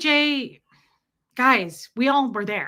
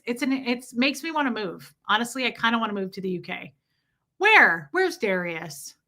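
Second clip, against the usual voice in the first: ordinary talk, nothing marked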